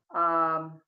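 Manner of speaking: normal talking voice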